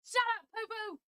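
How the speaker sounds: high, playful shut-up to a pet; squeaky scolding. Real, not acted.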